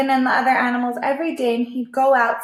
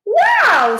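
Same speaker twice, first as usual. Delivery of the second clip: high, loud amazed wow; a big high-pitched wow from Cowboy; squeaky startled wow; Cowboy's wow; 12 semitones higher, 8 dB louder than usual. A narrator's reading. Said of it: "says Cowboy".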